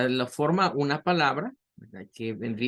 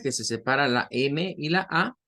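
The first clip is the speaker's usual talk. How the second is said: ordinary delivery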